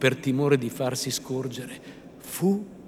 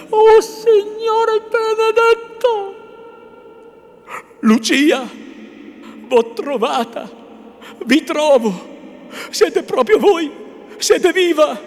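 loud, high cry of overwhelmed joy; drawn-out exclamation, then breathless amazement; trembling cry; 11 dB louder, slower than usual. A narrator's reading. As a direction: cry out as if finding a lost love alive.

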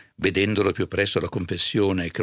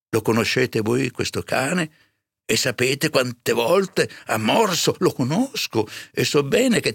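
high, retorting priest voice; agitated curate; 7 semitones higher, 3 dB louder than usual. A narrator's reading. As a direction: argue back, high and upset. Curato ribatte impaurito alla serva. (curato agitato che ribatte, voce acuta)

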